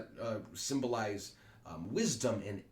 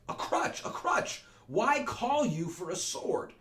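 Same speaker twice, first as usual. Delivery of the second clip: mocking wife; 12 semitones higher, 4 dB louder, slower than usual. Voicing Capulet's wife mocking.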